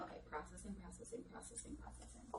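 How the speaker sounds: fading from a murmur into a whisper; repeated word that trails off to a whisper; processing brain. Retold or imitated. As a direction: let the words wind down to a whisper like a brain catching up. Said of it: "giving your brain the time".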